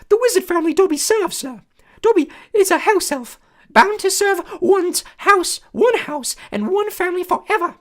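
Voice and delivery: high, loud squeaky elf voice; a small eager servant creature, awed; squeaky house elf. A narrator's reading. House elf greeting Harry, high squeaky and awed. Dobby voice.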